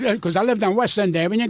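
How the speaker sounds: ordinary delivery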